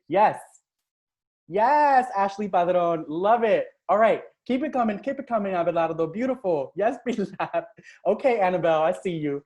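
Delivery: loud, high, rapid hype for the chat; really getting carried away cheering; excited cheering. Real, not acted. Coach shouting out viewers in chat, excited. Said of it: "I'm getting excited".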